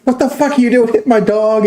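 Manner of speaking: high-pitched mock yell at the kid; retold yell. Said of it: "yelling at me".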